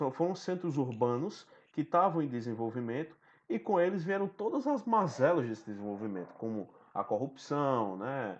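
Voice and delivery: ordinary delivery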